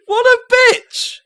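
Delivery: very high, loud, drawn-out indignation; outraged shout. Real, not acted. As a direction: cry out in comic outrage, stretching the words. Mock outrage at the wife.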